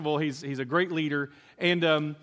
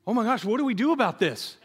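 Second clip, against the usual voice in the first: higher-pitched, hammed-up worry; mock parental dismay; 5 semitones higher than usual. Parents react to their son's complaint, in comic mock dismay. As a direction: play a flustered parent for laughs.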